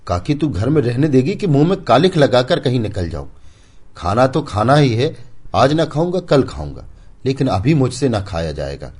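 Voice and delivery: high, loud exasperated complaint; loud, irked young man refusing to eat; irritated outburst. Acted. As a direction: vent your frustration at the aunt. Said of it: "Raghu ने झुंझलाकर कहा".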